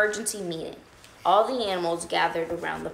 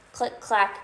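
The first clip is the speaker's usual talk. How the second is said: ordinary delivery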